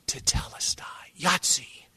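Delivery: unvoiced dying whisper; whispered last word. Dying man to those gathered near, whispering. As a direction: breathe it out as last words.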